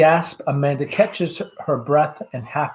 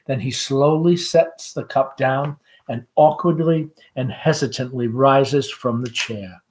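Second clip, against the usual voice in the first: normal talking voice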